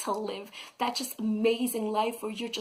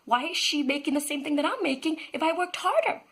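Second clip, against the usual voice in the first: higher mock-outraged complaint; indignant teacher; 7 semitones higher than usual. Teacher protesting that a colleague got equal pay, mock-outraged. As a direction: protest the unfair pay, pitched up. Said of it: "I'd be like".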